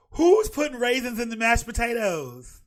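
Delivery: loud, slow, disbelieving; appalled host shouting at the idea; loud outrage. Real, not acted. Outraged at raisins.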